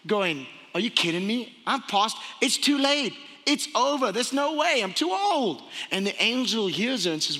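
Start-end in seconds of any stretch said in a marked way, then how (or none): 0.7-5.6 incredulous, climbing high in pitch; sarcastic disbelief. Acted. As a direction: scoff sarcastically, pitch rising with each objection. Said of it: "She was laughing sarcastically".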